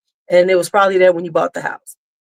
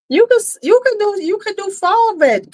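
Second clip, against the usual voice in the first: high excited advice; 12 semitones higher than usual. Real, not acted.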